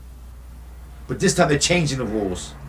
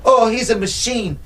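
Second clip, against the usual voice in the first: boastful trainer; 7 semitones higher than usual. Retold or imitated. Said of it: "Remember that?".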